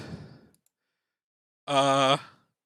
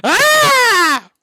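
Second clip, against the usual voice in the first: high frustrated scream; 6 dB louder than usual. Real, not acted. Frustration at draw.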